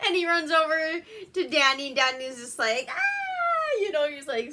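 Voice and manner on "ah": very high mock scream; high-pitched comic shriek of fear; imitated scared scream. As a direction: squeal as if terrified of a dog. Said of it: "super scared the dog is gonna".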